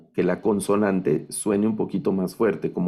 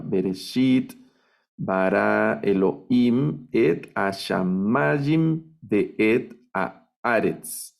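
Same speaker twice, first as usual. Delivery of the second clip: slow syllable-by-syllable reading, marking -shit, -him, MA and A in Aretz; lectura con acentos; slower than usual. Shown as a demonstration. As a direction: read it deliberately, stretching each stressed syllable of Bereshit bara Elohim. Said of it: "Entonces ya puedo leerlo". (lectura lenta del hebreo, marcando cada sílaba acentuada)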